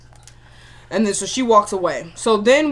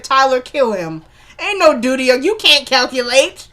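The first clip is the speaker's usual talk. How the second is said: drawn-out, raised, incredulous complaint; exasperated scolding; 3 semitones higher, 4 dB louder, slower than usual. Real, not acted. Recapper scolding the writer over silly dialogue, exasperated.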